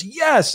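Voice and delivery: firm, emphatic YES. Retold or imitated. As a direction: land the retold answer with conviction.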